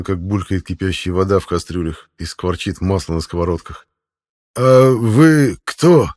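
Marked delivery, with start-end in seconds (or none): 4.6-6.1 slow, hesitant question, pitched up; timid old man. A narrator's reading. Frightened old cook to armed strangers, timid, drawn-out question. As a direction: venture the question haltingly, drawing it out. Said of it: "осмелился подать голос очень старый Велидара".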